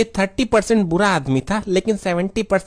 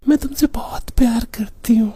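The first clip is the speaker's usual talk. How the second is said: put-on breathy whispered girlish voice; man doing a woman's voice; 4 semitones higher than usual. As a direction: whisper it like a sweet girlfriend. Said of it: "तुम्हारी आवाज तो बहुत अच्छी है".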